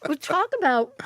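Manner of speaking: words round a bite of cake; mouth full. Real, not acted. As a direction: talk through a mouthful. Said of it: "pardon me for talking with my mouth full".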